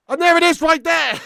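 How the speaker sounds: loud, high celebration; triumphant shout. Real, not acted. Shouting over the goal replay.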